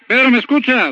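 usual speaking voice

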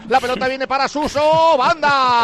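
projected radio football call; live play-by-play. Real, not acted. Commentator returns to the ball after teasing colleagues, live play-by-play. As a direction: stretch the last word as play moves wide.

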